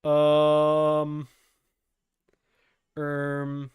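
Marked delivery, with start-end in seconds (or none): none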